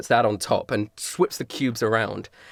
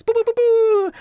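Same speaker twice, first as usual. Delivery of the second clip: high, loud mouth-made trumpet fanfare; high-pitched "boo-boo" bugle imitation; little trumpet toots; 5 dB louder than usual. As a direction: toot like tiny rabbit trumpets. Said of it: "rabbits that are playing these little trumpets".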